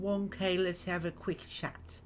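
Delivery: Helga. Acted. Game character opening a chat, tentative put-on voice. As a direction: try out a careful character voice, softly. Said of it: "trying to remember the voice I did for Helga".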